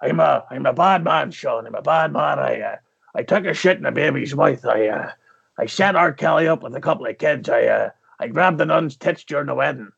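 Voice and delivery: low brooding Irish growl; Liam Neeson impression. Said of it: "key to a Liam Neeson impression".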